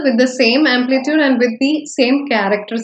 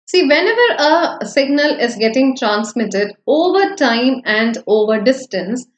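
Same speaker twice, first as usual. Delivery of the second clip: usual speaking voice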